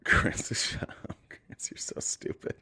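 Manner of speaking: hushed, unvoiced whisper under a laugh; whispered aside. Real, not acted. Host to Chris off to the side, amused whisper. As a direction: whisper it privately, drawn out at the end.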